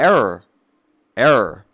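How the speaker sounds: loud robotic error beeps; glitchy computer voice; glitching robot. Pretend. Mocking opponent as broken computer.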